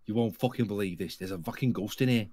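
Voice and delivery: hint of a Scouse ghost hunter; Derek Acora impression. Streamer redoing his impression for friends, understated Scouse medium. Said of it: "I was doing my impression of, um, Derek Acora".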